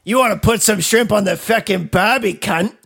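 loud, high, brash Australian; Australian accent. Shown as a demonstration. Answering the accent game challenge, loud hammed-up Australian accent. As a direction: belt it out in broad Australian.

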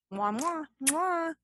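exaggerated spoken air kisses; blown kisses. Blowing kisses at sign-off.

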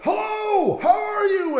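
booming, high-pitched shout of hello; overly loud, full-blast greeting; bellowing greeting. Retold or imitated. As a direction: bellow it so the walls echo. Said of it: "a louder tone of voice".

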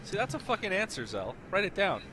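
ordinary delivery

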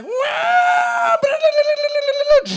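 shrieked high blah-blah riff; wild high guitar noise vocals; mock guitar wail. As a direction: imitate a screaming guitar solo. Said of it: "he's gonna be like".

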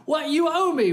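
high, heated demand; angry demand. Acted. Servant choking the man who owes him, heated high demand. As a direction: throw the demand at him like a threat. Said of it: "He gets mad".

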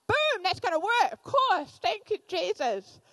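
high, loud burst of relieved joy; excited relief. Retold or imitated. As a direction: shout it like the answer just clicked. Relieved answer to prayer.

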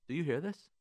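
usual speaking voice

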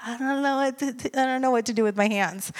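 hushed and helpless; flustered mumble. Retold or imitated. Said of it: "And you're like".

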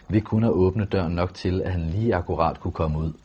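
ordinary delivery

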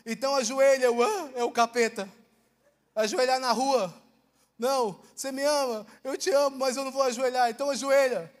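loud, emphatic back-and-forth; acted heated argument. Preacher acts out arguing with God over kneeling, loud and insistent.

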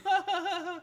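high fake "ha ha ha"; imitated laugh track. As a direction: fake canned sitcom laughter. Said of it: "when he puts on the laugh track".

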